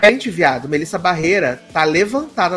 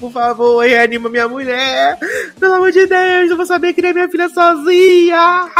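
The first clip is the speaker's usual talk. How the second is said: high, loud hammed-up plea to the paramedics; mock pleading husband; 12 semitones higher, 5 dB louder than usual. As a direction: beg the paramedics desperately, overplayed. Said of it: "falando com os paramédicos". (marido implorando aos paramédicos, agudo e alto)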